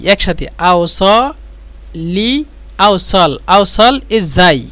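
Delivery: slow, careful আওসাল ইজাই; slow teaching pronunciation; slow demo. Shown as a demonstration. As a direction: sound out আওসাল ইজাই syllable by syllable. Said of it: "এভাবে বলবেন".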